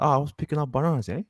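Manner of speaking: lightly hammed Australian working-holiday voice; mock Australian accent. Imitating the brother's Australian accent while retelling the trip. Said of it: "He said".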